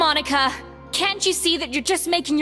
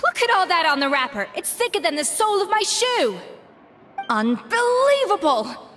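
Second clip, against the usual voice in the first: high-pitched, outraged rant; scandalized, raised-pitch tirade; indignant outburst; 5 semitones higher than usual. Acted. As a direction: fume over a botched dish. Chef's outburst over bad cooking.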